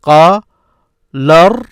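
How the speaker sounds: "قال ر-" as qaa, pause, larr; teacher's projected split of "قال ر-"; broken into syllables. Shown as a demonstration. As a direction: sound out "قال ر-" loudly in separate syllables. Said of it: "This is what you hear".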